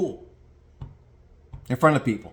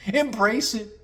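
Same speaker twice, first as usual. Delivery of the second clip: high, airy put-on voice; mocking naive wonder; mock liberal idealist; 10 semitones higher, slower than usual. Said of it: "liberal-minded thinking".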